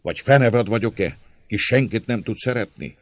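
impassioned rhetorical question; passionate. A narrator's reading. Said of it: "Kérdé György szenvedéllyel".

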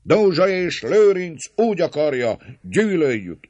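high, loud angry interjections; crowd shouts. A narrator's reading. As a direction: cut the speaker off from the crowd. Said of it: "íszózatok szakíták félbe a szólót".

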